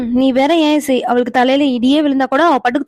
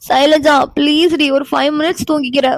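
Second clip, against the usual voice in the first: sleepy pleading. Acted. Sleepy Meera begs five minutes. (தூக்கக் கலக்கத்தில் கெஞ்சல்)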